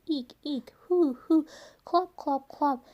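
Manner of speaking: plain reading voice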